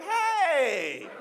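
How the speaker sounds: ordinary talk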